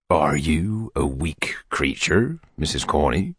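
slow, tender whisper. A narrator's reading. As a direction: lean in and whisper it slowly. Beadle wooing the widow.

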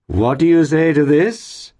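high, loud, drawn-out flourish; showman-like gentleman's exclamation; proud cry. A narrator's reading. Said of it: "cried Mister Pecksniff".

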